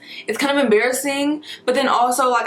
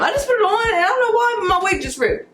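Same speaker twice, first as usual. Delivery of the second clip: surprised; 10 semitones higher than usual. Real, not acted. Wig rips while putting on.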